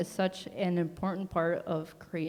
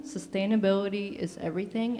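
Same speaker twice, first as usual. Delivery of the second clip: ordinary talk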